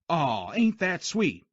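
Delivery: slow sarcastic coo; mock the sentiment. Sergeant mocks sentiment.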